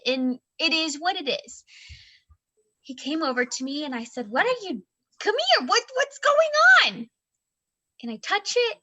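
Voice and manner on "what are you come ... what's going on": high-pitched, rising disbelief; retold alarm. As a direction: blurt it out, baffled and high. Retold alarmed question to child.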